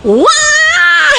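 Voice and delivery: sudden high loud wail; mock child's loud wail; child's real wail imitated. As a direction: burst into a high crying wail. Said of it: "anak nangisnya serius nih".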